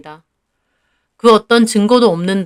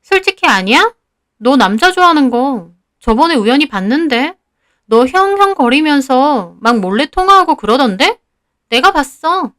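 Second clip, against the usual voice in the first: put-on higher-pitched girl's voice, needling; schoolgirl's insinuating questions, pitched up; accusing schoolgirl; 4 semitones higher than usual. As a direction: taunt a classmate with what you saw. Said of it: "제 말에 유지 걔는 말하더라고요".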